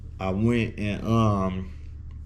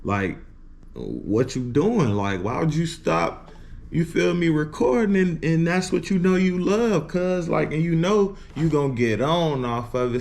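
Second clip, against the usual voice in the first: imitating cousin; 6 semitones higher than usual. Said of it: "He was telling me".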